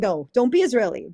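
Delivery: ordinary talk, nothing marked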